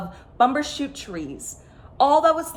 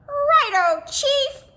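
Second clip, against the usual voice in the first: barked reply; much slower than usual. A narrator's reading. The men answering their chief, barked. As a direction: snap it back like eager soldiers. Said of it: "barked the men".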